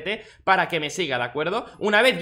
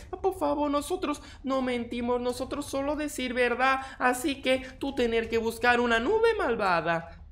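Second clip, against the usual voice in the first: higher, softer broken-Spanish villager; 6 semitones higher, 4 dB quieter than usual. Acted. Villager insisting to the player, higher and softer, in broken Spanish. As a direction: plead like a simple earnest villager.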